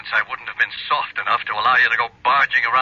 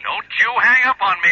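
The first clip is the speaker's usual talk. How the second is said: angry shout; 12 semitones higher than usual. Acted. Father yells at his daughter not to hang up, furious shout. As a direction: stop her hanging up with a furious shout.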